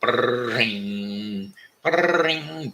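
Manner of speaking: mock ringing phone, loud; telephone ring. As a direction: imitate the retro phone bell. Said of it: "the old retro phone rings".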